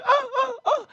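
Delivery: high, loud mock orgasm moans. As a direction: moan it up like a porn scene. Mimicking porn scene moan.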